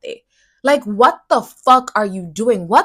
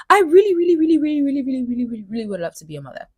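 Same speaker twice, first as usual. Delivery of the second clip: high, gushing repeated really; yearning; 4 semitones higher, much faster than usual. Real, not acted. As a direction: pour out the longing. Dreaming of motherhood.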